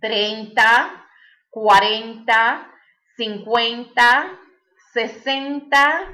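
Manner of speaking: each number drawn out with a rising end; slow, deliberate counting for learners; counting slowly with a rise on every number; slow drilled counting. Shown as a demonstration. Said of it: "all you do is add those two".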